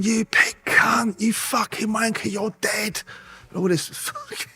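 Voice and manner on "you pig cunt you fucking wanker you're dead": high, drawn-out mock yelling; jeering prisoners. Inmates heckling a jailed policeman as he walks through, menacing jeers. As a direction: imitate distant threats, high and slow.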